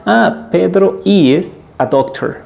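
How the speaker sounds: slow, high model sentence. Shown as a demonstration. Teacher modeling the answer about Pedro, slow. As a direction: say it slowly with the name. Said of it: "repetir el nombre y decir".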